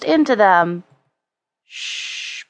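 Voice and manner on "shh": soft, voiceless hush; long whispered "shh"; whispered shush. A narrator's reading. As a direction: hush a little friend with breath alone. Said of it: "whispered Father Christmas".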